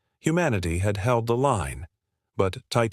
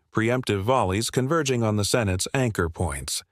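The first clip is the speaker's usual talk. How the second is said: usual speaking voice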